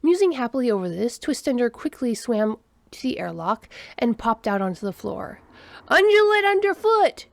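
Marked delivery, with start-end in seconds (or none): 5.9-7.3 loud, high holler; hollered warning. A narrator's reading. Human hollers to others as alien arrives, hollering. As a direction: call out sharply to the whole room. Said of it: "the nearest human hollered".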